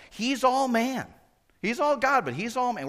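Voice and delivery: high, loud "all" in "He's all man"; stress on all. Shown as a demonstration. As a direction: punch "all" each time. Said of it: "God's trying to get a point across".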